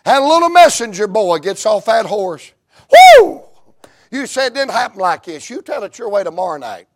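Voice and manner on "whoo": high shouted whoop of joy; joyful preacher whoop. Real, not acted. Preacher to congregation, a loud joyful whoop. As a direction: let out a loud excited whoop. Preacher erupts mid-story.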